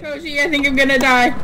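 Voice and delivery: panicked yelling while fleeing; frantic, terrified cries; scared horror game streamer. Real, not acted.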